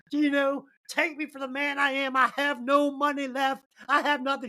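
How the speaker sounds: lightly hammed-up humble romantic; mock cheesy hero. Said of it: "Walt Disney garbage".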